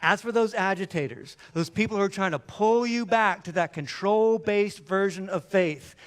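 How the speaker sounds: usual speaking voice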